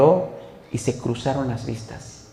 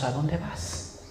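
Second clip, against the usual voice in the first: hushed question; 11 dB quieter, much slower than usual. Retold or imitated. Peter asks Jesus.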